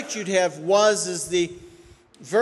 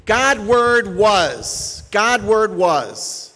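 "God Word was" said very slowly, each word separate, loud and higher; 4 semitones higher, 7 dB louder, much slower than usual. Shown as a demonstration. Preacher shows the congregation the real word order, slow and heavy. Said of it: "this is what this means".

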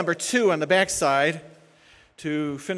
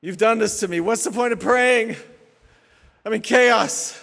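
raised, agitated complaint; accusing God; 4 semitones higher, 5 dB louder than usual. Acted. Preacher acting an anxiety-filled believer blaming God, agitated. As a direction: throw the blame at God, worked up.

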